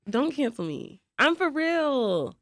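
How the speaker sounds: normal talking voice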